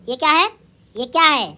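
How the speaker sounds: usual speaking voice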